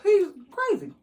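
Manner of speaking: squealed he's-crazy verdict; incredulous. Retold or imitated. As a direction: declare it in wide-eyed disbelief. Her verdict on the date.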